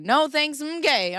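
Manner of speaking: high, loud mock-sassy retort; sassy comeback. Joking back at the chat with a sassy quip. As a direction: toss it off as a cheeky joke.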